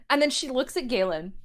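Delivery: ordinary delivery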